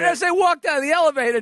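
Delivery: ordinary delivery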